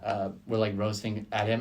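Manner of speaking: ordinary talk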